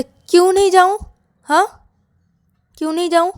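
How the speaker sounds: loud, high-pitched demand; angry challenge. Acted. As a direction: confront them with an indignant question. Rahul challenges Ravi's plea. (गुस्से में ज़ोर से सवाल)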